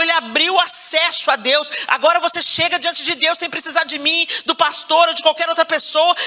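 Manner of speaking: high, driving sermon rising into fervent prayer; full-force preaching. Real, not acted. Preacher showing the church how to beg God for healing, full-force preaching. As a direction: preach it urgently, then plead for healing.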